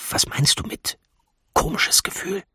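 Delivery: puzzled, hushed whisper; voiceless, secretive query; whispered question. A narrator's reading. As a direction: whisper back so no one hears. Hiding, whispered exchange with her.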